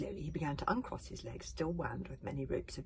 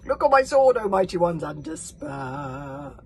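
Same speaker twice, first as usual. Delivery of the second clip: loud grandiose bragging; pompous boast; 12 dB louder than usual. Acted. Vain chief bragging loudly before his crowd. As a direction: declaim it like a vain warlord.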